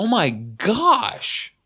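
slow high drawn-out gasp; stunned. Retold or imitated. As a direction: stretch it out in shock. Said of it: "you're like".

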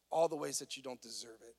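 ordinary talk, nothing marked

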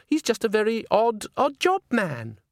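put-on high, slowing, affected girlish voice; dismissive diva. A narrator's reading. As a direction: explain it away in a high, silly voice. Christine explains Walter to Agnes.

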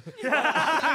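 bursting out laughing; big loud laugh. Real, not acted. Laughs at own story.

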